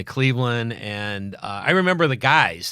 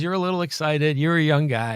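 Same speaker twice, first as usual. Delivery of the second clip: imitating Brooks; 6 semitones higher, 4 dB louder than usual. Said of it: "He goes".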